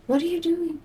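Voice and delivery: high, soft pet voice; talking to the cat. Real, not acted. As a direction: coo at your cat.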